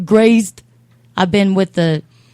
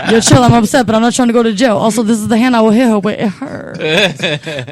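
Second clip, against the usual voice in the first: laughing; 3 semitones higher, 6 dB louder than usual. Retold or imitated. Laughing, calling him off.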